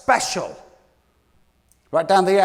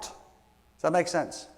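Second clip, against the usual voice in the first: ordinary talk, nothing marked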